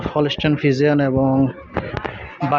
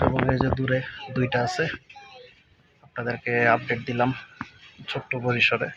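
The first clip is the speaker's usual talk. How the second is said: softer, discreet night-time update; speaking quietly; 3 semitones lower, 7 dB quieter than usual. Real, not acted. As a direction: keep your voice down so you aren't overheard. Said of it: "আস্তে আস্তে কথা বলতেছি, যাতে বা শোনা না যায়". (নিচু গলায় আস্তে আস্তে বলা)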